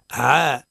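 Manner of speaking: "عا" with a plain long a, not over-darkened; balanced ayn. Shown as a demonstration. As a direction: model "عا" neither heavy nor tilted to e. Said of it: "ما هيش عا".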